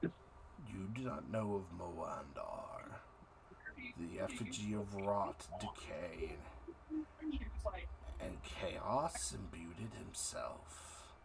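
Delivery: slow, low, soft rotting god; eldritch horror. Acted. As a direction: let the ancient god rumble slowly. Deity answers player's character.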